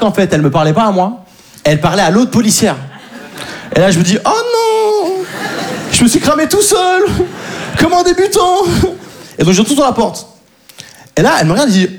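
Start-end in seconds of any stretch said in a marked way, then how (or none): 4.2-8.6 high, laughing self-scolding; dismayed thought. Retold or imitated. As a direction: groan at your own blunder while laughing.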